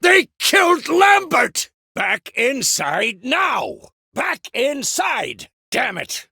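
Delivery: drawn-out, desperate yelled orders; anguished battle shout. Acted. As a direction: shout everyone back to safety. Shouting after comrade falls.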